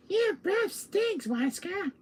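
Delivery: very high, slow whine; drawn-out squeaky villain; Salazar voice. Acted. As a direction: complain in a silly little high voice. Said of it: "who's doing the Salazar voice?".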